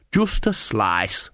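very slow, absent-minded reply; drawn-out, absent character voice; without thinking. A narrator's reading. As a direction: let it slip out slowly, distracted. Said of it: "without thinking".

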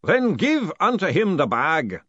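slow, loud ceremonial order; drawn-out ritual command; booming. A narrator's reading. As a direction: boom it out grandly. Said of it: "boomed the distant voice".

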